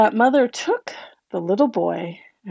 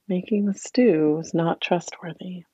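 ordinary delivery, nothing marked

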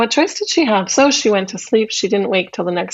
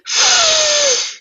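witch sniffing. Acted. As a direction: sniff the air for prey.

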